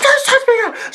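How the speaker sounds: very high imitated screech; shrieked warning. Auntie in a scuffle with cousin, shrieked warning. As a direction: screech it in outrage. Retold scuffle, shouting back.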